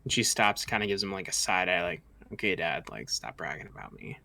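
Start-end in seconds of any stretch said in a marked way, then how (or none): 2.3-4.2 low, much softer aside to dad; embarrassed mutter. Acted. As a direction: mutter it so only dad hears. Princess embarrassed by bragging father.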